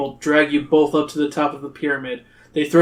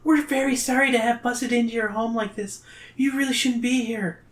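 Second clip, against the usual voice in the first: high, meek apologetic voice; small high girl's voice, politely apologising; Zoe; 8 semitones higher, 5 dB quieter than usual. A narrator's reading. Zoe apologises to the king, high and meek. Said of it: "Zoe says".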